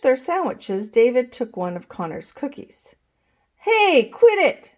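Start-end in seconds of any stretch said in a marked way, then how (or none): 3.6-4.7 put-on high, louder kid's quit it; boy telling off a cookie thief; annoyed protest. A narrator's reading. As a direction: snap at him to stop. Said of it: "said Connor".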